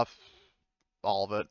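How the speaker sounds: ordinary talk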